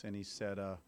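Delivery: ordinary delivery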